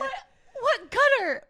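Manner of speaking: high, loud, laughing question; laughing disbelief. Real, not acted. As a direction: ask it through laughter.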